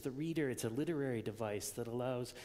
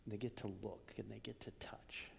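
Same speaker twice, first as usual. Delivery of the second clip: 1 awed whisper; 4 semitones lower, 9 dB quieter than usual. Real, not acted. Hushed sermon climax.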